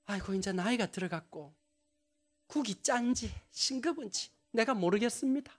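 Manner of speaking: gently voiced mother, soft and self-deprecating; 아이고 이제 나이가 said softly and meekly; soft humble mother. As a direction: hum it out like a modest old mother fishing for praise. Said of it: "말을 이렇게 하세요".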